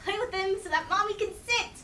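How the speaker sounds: ordinary talk, nothing marked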